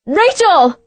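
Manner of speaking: high, desperate scream of a name. A narrator's reading. Adria screams for Rachel on the beach, high and desperate. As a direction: scream her friend's name in panic. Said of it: "She screamed".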